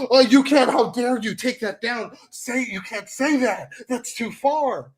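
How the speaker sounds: high-pitched, scandalized critic impression; hammed-up, mocking pearl-clutcher; mock outrage. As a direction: wail in exaggerated horror, drawing out the ends. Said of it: "it's like".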